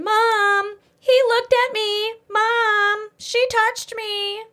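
put-on high, whining child tattling; whiny kid complaining. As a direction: whine like a kid telling on a sibling. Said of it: "and complain".

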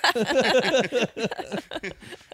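high burst of laughter; loud laugh. Real, not acted. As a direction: cackle out loud. Laughing at own bit.

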